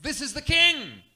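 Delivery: high and loud declaration; proclaiming. Retold or imitated. Pilate's sign declaring Jesus king, loud high proclamation. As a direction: announce it like a royal proclamation. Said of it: "puts a sign up in three languages declaring".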